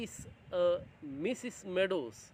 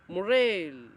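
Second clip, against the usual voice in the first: bird-like cry; 8 dB louder than usual. A narrator's reading. Teacher reading the bell scene, a loud bird-like cry. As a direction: call it out like a bright bird.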